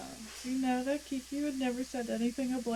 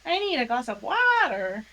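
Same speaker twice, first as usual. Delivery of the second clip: Jersey accent; 6 dB louder than usual. Shown as a demonstration. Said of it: "Jersey accent thing is when I say water".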